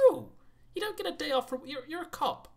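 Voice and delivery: indignant boss refusal. Acted. Refusing officer, acting chief.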